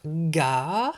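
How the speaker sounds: long rising GA; "ga" held, climbing at the end; syllable stretched. Shown as a demonstration. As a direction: draw out "ga" on its own. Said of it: "On entend d'abord ga".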